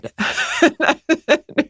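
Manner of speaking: high rueful laugh. Real, not acted. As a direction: laugh it off, wry. Laughing at bad luck.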